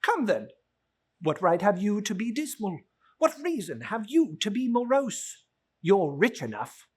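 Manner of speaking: a hint of the cheerful nephew, amused disbelief; lightly voiced nephew. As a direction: gently tease the grumpy uncle. Nephew retorts.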